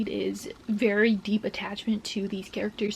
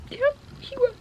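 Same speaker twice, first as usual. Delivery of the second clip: crying. Real, not acted. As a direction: voice cracking up high through tears. Moved by Snape's tribute.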